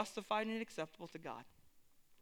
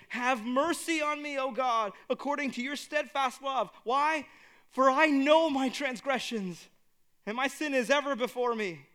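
crying out brokenly; 5 semitones higher, 6 dB louder than usual. Acted. Preacher reading David's psalm as a broken, loud cry. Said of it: "cry out in brokenness".

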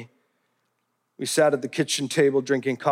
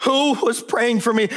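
higher, pressing, demanding an answer; cross-examining attorney; 7 semitones higher than usual. Retold or imitated. Daughter interrogates her father, insistent and higher. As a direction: pin him down like a lawyer. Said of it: "like that of a cross-examining attorney".

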